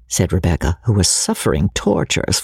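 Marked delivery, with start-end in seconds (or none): none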